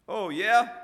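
loud, high-pitched sneer; sneering challenge. A narrator's reading. Mistrust to a boasting rival imp, sneering. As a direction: throw it back as a scornful challenge. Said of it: "sneered Mistrust".

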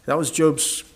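ordinary talk, nothing marked

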